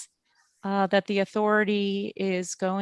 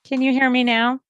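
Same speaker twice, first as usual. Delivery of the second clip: louder, raised pitch, rising question; calling out; 4 semitones higher, 9 dB louder, faster than usual. Real, not acted. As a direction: call out to be heard over a bad line. Calling out after the audio dropped.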